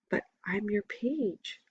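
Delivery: soft, slow plea to his master; softly. A narrator's reading. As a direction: say it softly, lost and unsure. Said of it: "I say softly".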